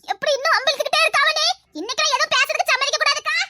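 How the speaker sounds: a wronged wife tattling; high put-on wife voice. Wife complaining to her sister-in-law, high put-on voice.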